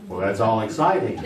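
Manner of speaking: ordinary talk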